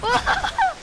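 high squeal. Real, not acted. Hype calling teammates.

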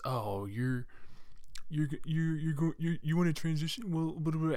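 lightly voiced scornful jerk; mocking bigot. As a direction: parody a dismissive critic, stammering then brushing it off. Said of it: "someone just goes".

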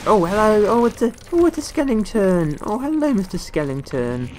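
playful high-pitched fondness; delighted greeting. Real, not acted. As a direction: greet it like an adorable surprise. Greets a skeleton in game.

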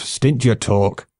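slow, stern command; stern old bowman. A narrator's reading. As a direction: slowly lay down the law. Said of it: "cried the old bowman Wat".

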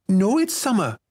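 put-on high, stubborn little girl. A narrator's reading. Barefoot girl denying she is cold, stubborn. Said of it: "she said stubbornly".

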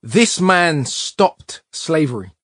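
very slow, weighty delivery stressing This; solemn punchline. A narrator's reading. Teacher revealing the painting to a child, solemn and slow. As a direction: lean on "This" and let it land slowly. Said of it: "add power to the punchline".

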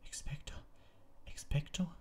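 weak whispered spell. A narrator's reading. Hermine whispers the spell, failing against the Dementors. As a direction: breathe it out, failing.

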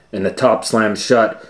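usual speaking voice

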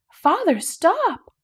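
high, slow cry of alarm; shouted. A narrator's reading. Princesses shout at their father to stop the wagon, alarmed cry. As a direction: stretch it out as a warning shout. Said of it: "the princesses shouted".

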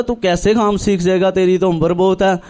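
ordinary talk, nothing marked

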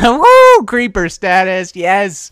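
high, loud drawn-out whoop; mock celebration. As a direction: cheer it like a big win. Mock celebration of creeper status.

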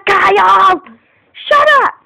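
high, loud annoyed shout; yelling at brother. Real, not acted. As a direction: snap at him to shut up.

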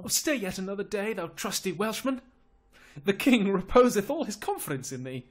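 high, urgent noble appeal; pleading Lord Salisbury. Acted. Salisbury begs the Welsh captain to stay, pleading.

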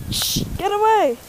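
high, urgent shooing; alarmed. Real, not acted. Owner shooing something from the dog, high alarm. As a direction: chase it off in a sudden fright.

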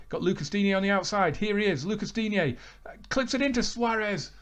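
high, urgent match commentary; live play-by-play. Acted. Commentating his own game to viewers, high and urgent. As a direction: call the chance as it happens.

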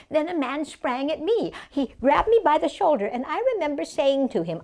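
high-pitched, alarmed storytelling; Mary Nolan, agitated recounting. Acted. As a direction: relive the attack as you tell it. Memoir continues, agitated.

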